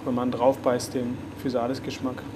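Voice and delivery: ordinary delivery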